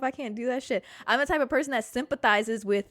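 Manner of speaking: ordinary talk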